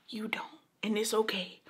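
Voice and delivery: soft breathy whisper to the viewer; a hushed, reassuring whisper. Real, not acted. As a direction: drop right down to a whisper. Intimate aside to viewers.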